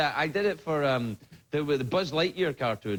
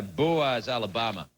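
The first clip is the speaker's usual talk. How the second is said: ordinary delivery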